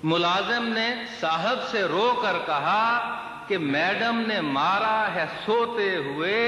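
measured mushaira declamation, words drawn out; lilting Urdu verse delivery; poetry recitation. Acted. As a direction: recite the couplet to a listening crowd. Recites servant's complaint verse.

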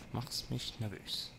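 quiet, slow grumble; muttered nervous complaint. Real, not acted. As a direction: say it slowly, under your breath. Repeats the complaint to an enemy.

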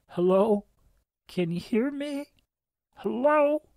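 high, distant woman calling out; faint cry for help. A narrator's reading. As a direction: call for help from far below. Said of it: "her cries for help".

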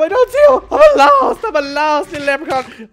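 high, loud goofy voice; silly lost leprechaun. Pretend. Gamer playing a lost leprechaun, high and goofy. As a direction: play a lost little leprechaun. Playful voice while searching.

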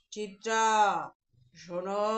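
low, resonant giant's call; deep booming voice. Acted. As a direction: boom it out as if from inside a pot. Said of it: "কি গম্ভীর গলার আওয়াজ".

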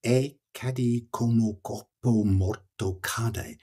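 "E cadì come corpo morto cade" spoken slowly, every syllable stressed; slow emphatic recital. Shown as a demonstration. Poet showing the listener the meter of Dante's line, slow and stressed. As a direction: hammer the iambic beat of the line. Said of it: "only more so".

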